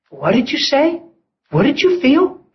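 high, rising, incredulous questions; startled mock quote. Woman questions the preacher, startled. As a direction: imitate her surprise, pitch climbing on each question.

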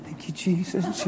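soft, under-the-breath thanks; hushed praise. Acted. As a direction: say a quiet little prayer of thanks. Acting himself praising while turning music down.

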